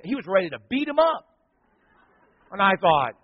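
usual speaking voice

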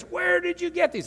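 high-pitched mock yell; furious dad. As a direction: imitate an angry father, pitch shooting up. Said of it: "he yelled, oh, he was furious".

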